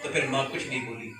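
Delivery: normal talking voice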